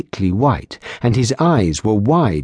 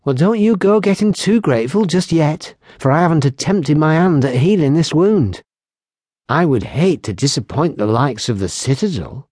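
put-on higher, lively young barmaid voice; teasing barmaid; 6 semitones higher than usual. Barmaid teasing the lieutenant about her healing, higher and playful. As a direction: tease them playfully, brightly pitched. Kylie teases the guests.

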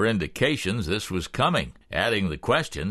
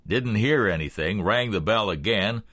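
plain reading voice

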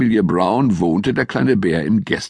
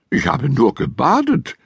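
a touch higher, offended bear; hurt; 6 semitones higher than usual. A narrator's reading. Little bear offended at the family's surprise, mildly hurt. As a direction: say it a little wounded. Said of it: "sagte der kleine Bär gekränkt".

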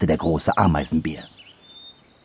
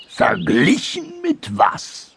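slow, higher questioning voice; challenging big anteater; 10 semitones higher, slower than usual. Acted. Big anteater challenging the dormouse, slow indignant question. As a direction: draw the question out, raised in pitch.

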